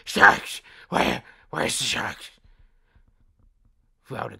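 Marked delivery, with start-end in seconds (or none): 0.0-0.6 panicked shout of alarm. Acted. Acts panic about sharks. 0.9-2.2 frantic, loud searching question; loud panicked asking. Acted. Acts panic about sharks.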